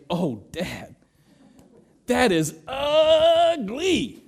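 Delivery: high, slow teasing daughter; mock daughter. Dad imitating daughter Joanna judging his outfit, high and drawn out. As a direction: stretch out a daughter's blunt verdict. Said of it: "she'd probably look at me and say".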